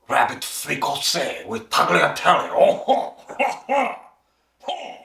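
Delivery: hammed-up French accent with ho-ho laughs; mock-French voice, chortling at the end. Shown as a demonstration. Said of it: "that's my best French accent".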